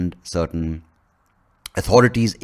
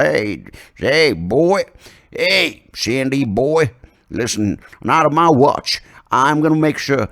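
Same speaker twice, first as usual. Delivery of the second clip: loud, higher, drawn-out warning; mock bossy president; 6 semitones higher, 5 dB louder, much slower than usual. President challenging a student entering the country, mock bossy shout. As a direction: call him out like a hammy lawman.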